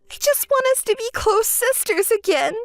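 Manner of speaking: high, whiny pleading sister; spoiled sister voice. Acted. Spoiled sister pleading with Maria, high and whiny. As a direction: wheedle her sister for help.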